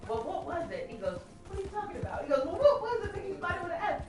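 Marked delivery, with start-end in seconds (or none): 0.1-0.9 high-pitched mock questioning; put-on high voice for the questioner; playful character voice. Questioner challenging a fellow prisoner's I-spy guess, mock high voice. Acted guessing-game argument. 1.5-4.0 high-pitched character voice; mock bickering. One I-spy player questioning the other, high mock bickering. Acted guessing-game argument.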